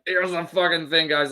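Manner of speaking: mock generic impression of a fighter; Strickland impression. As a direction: imitate a fighter's stock opener. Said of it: "that's literally all he does".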